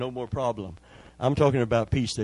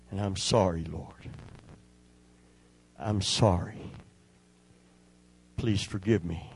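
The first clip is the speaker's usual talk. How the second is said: quiet prayer; 4 semitones lower, 4 dB quieter than usual. Retold or imitated. Father's retold prayer at hospital.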